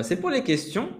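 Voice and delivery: usual speaking voice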